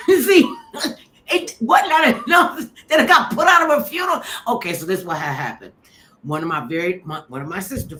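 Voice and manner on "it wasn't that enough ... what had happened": ordinary delivery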